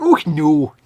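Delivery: loud put-on Edinburgh voice; mock Edinburgh exclamation; intense Edinburgh accent. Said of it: "It's like intense Edinburgh".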